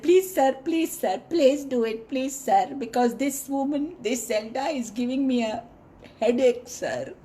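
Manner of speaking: high, stammering, pleading monkey; monkey begging the lion not to eat him; nervous monkey. A narrator's reading. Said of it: "So the monkey said".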